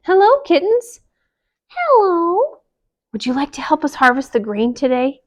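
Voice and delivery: bright high hello; cheery hen welcoming kittens; high chicken greeting. Acted.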